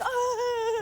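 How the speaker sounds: put-on high-pitched girlish gasp at a ring; high squeal. Woman imitating girls seeing the ring, squealing. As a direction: squeal like a thrilled fiancée. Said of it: "one of those girls that looks at the ring right away".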